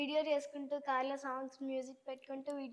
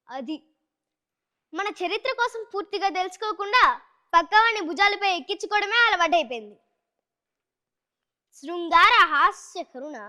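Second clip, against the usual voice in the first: Prakash Raj monologue; 9 dB louder than usual. Acted. Child actor continuing the film monologue, loud and lecturing. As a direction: scold the listener with grand theatrical pride. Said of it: "Prakash Raj గారిది dialogue".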